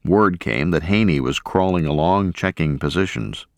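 plain reading voice

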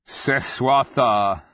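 loud, stretched summons; calling out. A narrator's reading. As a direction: call the name out, drawing it long. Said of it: "the Nonman called".

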